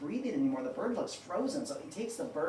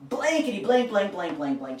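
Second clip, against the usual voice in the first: mock cursing; 2 semitones higher, 7 dB louder than usual. Bird's cursing stood in by blanks.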